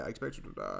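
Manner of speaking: deep mock villain threat; Blofeld impression. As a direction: growl it down low like a Bond villain. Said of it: "Ah, Mister Bond".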